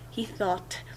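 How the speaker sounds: breathy whisper, barely voiced; hushed aside in the narration. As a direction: whisper it like a secret thought.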